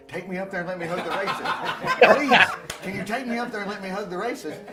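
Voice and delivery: loud, high pleading with a laugh; hammed-up eager pleading; mock eager begging. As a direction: beg like excited students. Students begging to hug racist.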